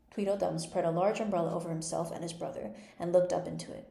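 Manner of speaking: usual speaking voice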